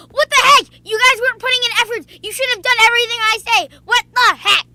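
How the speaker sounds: loud furious rant; freaking out. Retold or imitated. Teammate freaking out after the team died, loud and angry. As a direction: blow up at the team, loud and fast. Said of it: "he freaked out".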